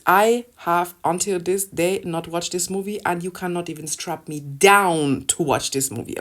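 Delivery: ordinary talk, nothing marked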